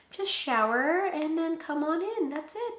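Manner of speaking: high, slowed-down nurse imitation; breezy nurse. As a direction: toss off instructions in a high voice. Imitates the nurse's casual answer.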